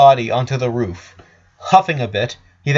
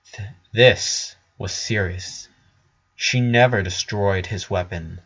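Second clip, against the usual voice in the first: usual speaking voice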